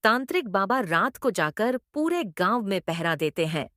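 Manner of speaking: ordinary delivery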